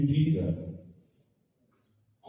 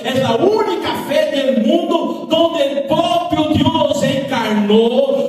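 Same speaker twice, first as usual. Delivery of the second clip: loud, ringing sermon delivery with rising intensity; full-force preaching; 10 semitones higher, 8 dB louder than usual. Acted. Pastor preaching to the church, loud and fervent. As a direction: preach it out to the congregation.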